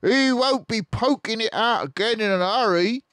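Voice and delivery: high, loud put-on farmer voice; Bunce the farmer. Bunce scoffing about the fox, high and loud. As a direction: sneer it as Bunce. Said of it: "Bunce said".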